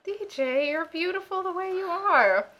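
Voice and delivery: high, slow, hammed-up sitcom sweetness; mock tender sitcom reassurance. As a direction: coo it like a cheesy sitcom family. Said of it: "they were all like".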